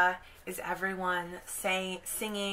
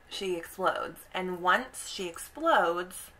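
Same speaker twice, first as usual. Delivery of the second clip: ordinary talk, nothing marked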